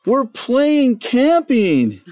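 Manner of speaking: put-on very high, slow, sing-song child voice; kids' voice. Father imitating his kids explaining their game. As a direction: draw it out like a delighted little kid. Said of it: "And they're like".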